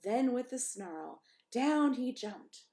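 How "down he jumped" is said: usual speaking voice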